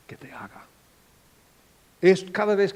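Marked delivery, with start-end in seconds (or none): 0.1-0.6 whisper. Acted. Jesus' gentle question.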